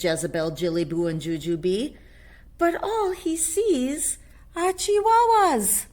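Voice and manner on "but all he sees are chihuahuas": put-on much higher, slow little-girl voice; sisters' voice. Sisters' voice in storybook.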